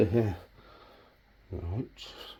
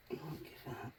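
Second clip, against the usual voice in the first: quiet; 3 semitones higher, 22 dB quieter than usual. Real, not acted. Repeats encouragement softly to himself.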